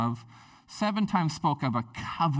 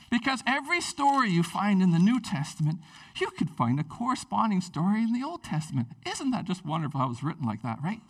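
usual speaking voice

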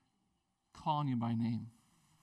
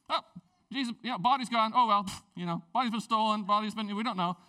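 mock disciples, louder and higher, waving it off; dismissive brush-off; 3 semitones higher, 6 dB louder, faster than usual. Disciples dismissing the women, louder and higher. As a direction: play the bored disciples shrugging off the news.

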